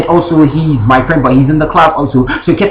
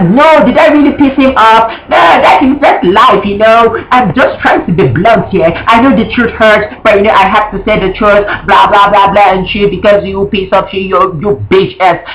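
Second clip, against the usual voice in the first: imitating a blunt rant; 6 semitones higher than usual. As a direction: mimic her defiant tirade, pitched up. Retells friend's blunt, angry rant.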